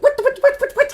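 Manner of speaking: very high, squeaky stammering disbelief; mock sputtering. Husband reacts to the trust fund demand, high mock sputter. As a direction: splutter in cartoonish high-pitched shock.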